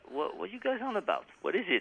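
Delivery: high, baffled what-are-you-on-about; baffled question. Retold or imitated. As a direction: sound totally lost and confused. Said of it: "and I was like".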